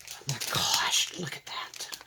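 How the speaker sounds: breathless whisper of wonder; a real hushed gasp of delight; awed whisper. Real, not acted. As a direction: whisper it in awe. Awed reaction opening the gift.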